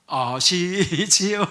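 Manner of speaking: very slow sly coaxing question; drawn-out wheedle. Retold or imitated. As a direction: stretch each syllable, coaxing. Lame man coaxing owner. (아주 느리게 늘어뜨린 능청스러운 물음)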